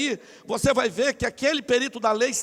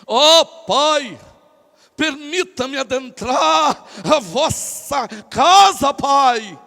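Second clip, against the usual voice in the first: loud, slow, pompous supplication; hammed-up holy voice begging the father; changed prayer intonation; 9 dB louder, much slower than usual. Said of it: "tu muda a entonação da tua voz". (súplica pomposa, alta e arrastada)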